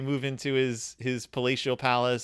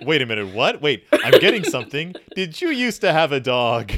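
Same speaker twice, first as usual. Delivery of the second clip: fake psychic; 8 dB louder than usual. Stan faking psychic insight.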